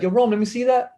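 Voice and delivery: high-pitched imitated call across the court. Park player asking kid for his ball, calling out high.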